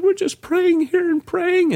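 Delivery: high, drawn-out voice of an upset mom; slow, high-pitched mock hysterics; hysterical mom. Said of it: "was hysterical and upset".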